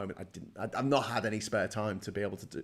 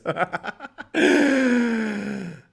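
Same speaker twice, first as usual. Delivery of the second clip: high burst of laughter; real laugh; 12 semitones higher, 9 dB louder than usual. Real, not acted. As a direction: laugh at his own mistake. Laughs at sign-off mixup.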